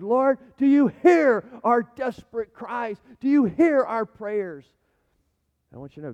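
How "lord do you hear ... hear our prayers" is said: loud, slow, desperate plea; crying out to the Lord. Acted. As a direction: stretch out the cry, high and pleading. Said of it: "as if we cry out to the Lord".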